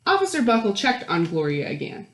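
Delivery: usual speaking voice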